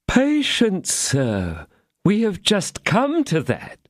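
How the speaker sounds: high, slow, begging host; pleading innkeeper. A narrator's reading. Innkeeper pleading with the angry musketeer, high and slow. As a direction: beg to be heard.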